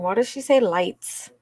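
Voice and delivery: ordinary talk, nothing marked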